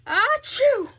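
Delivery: loud high sneeze. Acted. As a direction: let out a big achoo. Gerald sneezes.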